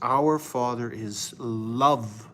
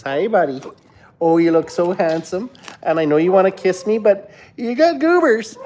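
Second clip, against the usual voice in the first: climbing higher and louder as you gush at the dog; doting dog talk; 5 semitones higher, 6 dB louder than usual. Real, not acted. Talking to his slobbery dog.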